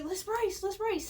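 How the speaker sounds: high, eager shouted dare; mock yell from a truck window; yelled challenge. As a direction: call it out across to the other car. Friends yelling from truck window.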